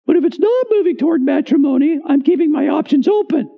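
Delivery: high falsetto reply; mock a sweetheart in falsetto; put-on high girlish voice; girlfriend's voice. Acting the woman's replies, falsetto.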